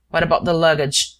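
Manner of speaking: usual speaking voice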